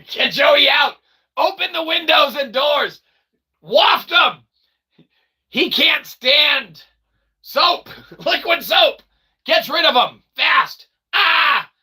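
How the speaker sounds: high, loud laughing panic; mock panic. Streamer hamming up panic to get Joey out, yelling. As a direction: yell orders through laughter.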